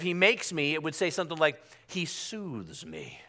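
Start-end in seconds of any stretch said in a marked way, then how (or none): 1.9-3.2 very slow, soft, low croon; soothing feel-good. Shown as a demonstration. Preacher mocking a feel-good psalm, soothing hush. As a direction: soothe them, drawing each word out gently. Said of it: "A feel-good psalm would start off".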